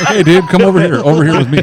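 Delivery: loud, high-pitched beckoning; playful calling out. Acted. Joker beckoning a dangerous wild animal, playful calling out.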